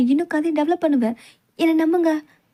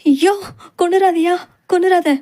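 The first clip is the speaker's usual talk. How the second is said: louder, higher pleading cries; frightened pleading; 3 semitones higher, 5 dB louder than usual. Acted. Heroine begging the captor not to kill her, frightened and loud. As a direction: beg for your life, loud.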